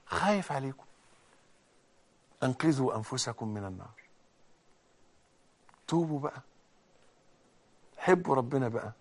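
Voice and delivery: quiet, tender pleading appeal; soft plea. Acted. Preacher gently urging the congregation to repent, soft plea. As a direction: softly beg them to repent.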